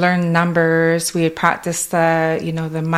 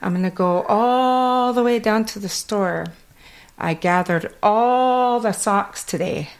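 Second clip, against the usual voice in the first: a light Kwakwala lilt through it; Kwakwala accent; 4 semitones higher than usual. Retold or imitated. Showing how Kwakwala shapes her English, light accent. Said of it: "my accent from Kwakwala goes into English".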